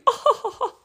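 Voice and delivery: high mimicked laugh of the cute little man; imitated laughing. Man laughs at the four identical orders, imitated laughing. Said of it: "And he starts laughing".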